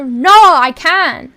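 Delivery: slow, stretched, loud NO; loud protest. Pretend. Streamer contradicting chat, loudly protesting. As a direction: push back with a drawn-out shout.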